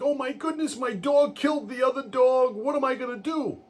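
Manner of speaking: high, frantic panic; panicking. Retold or imitated. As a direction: squeal it out like a man who just found the dead dog. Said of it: "now our insured is panicking".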